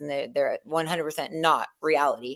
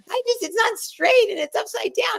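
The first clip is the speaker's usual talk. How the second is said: put-on upset girl shout. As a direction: play a girl losing her mind over a tie. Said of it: "and she was like".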